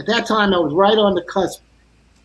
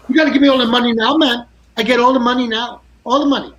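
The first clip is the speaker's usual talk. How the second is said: fast, higher, louder greedy demand; Jack demanding; 5 semitones higher, 3 dB louder, faster than usual. Retold or imitated. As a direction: rattle it off like a pushy bandmate. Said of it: "he's like".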